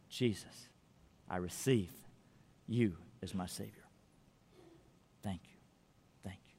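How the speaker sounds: very soft, low prayer with pauses; hushed prayer. Real, not acted. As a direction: lead them phrase by phrase, barely audible. Leads a prayer.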